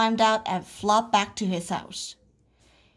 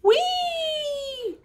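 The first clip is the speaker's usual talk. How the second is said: long, very high whee; gleeful whee. A narrator's reading. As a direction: squeal it out on a swing. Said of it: "sang Froggy".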